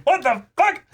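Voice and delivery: shrieking high and loud in disbelief; shocked outburst. Pretend. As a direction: scream it like stunned parents. Parents' shocked reaction voiced.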